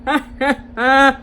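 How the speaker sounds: the Uber driver's creepy laugh, mimicked; imitated creepy laugh. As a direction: imitate a weird drawn-out laugh. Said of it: "Let me show y'all how he was laughing".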